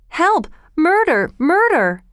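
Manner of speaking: high, alarmed cries; screaming for help. A narrator's reading. Oliver rings the bell for help, high urgent screams. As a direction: scream out the alarm, each word separate. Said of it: "he screamed".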